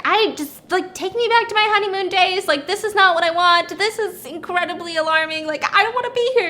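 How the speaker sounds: high, whiny mock panic; hammed-up rising distress; imitated freaking out. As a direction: protest in a high, overwhelmed voice. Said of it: "she starts freaking out".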